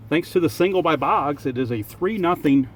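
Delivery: ordinary talk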